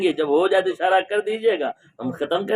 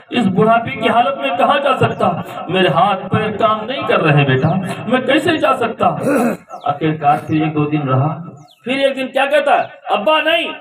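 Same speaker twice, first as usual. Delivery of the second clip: high, loud storytelling sermon; preacher voicing the harsh son; fiery preaching; 6 semitones higher, 3 dB louder than usual. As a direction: preach it out with rising fervour. Preaching the story at full force.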